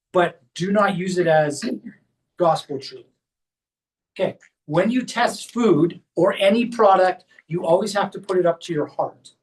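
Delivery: ordinary talk, nothing marked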